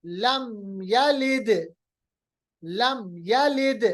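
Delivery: slow लम यलिद with d bounced; qalqalah. Shown as a demonstration. Teacher gives another dal example, यलिद with springing d. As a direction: finish यलिद on an echo "yalide". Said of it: "सिर्फ दाल पे होता है".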